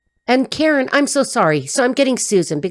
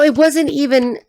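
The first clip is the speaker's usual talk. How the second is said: ordinary delivery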